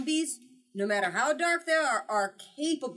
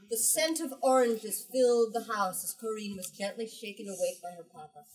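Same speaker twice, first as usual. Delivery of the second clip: ordinary talk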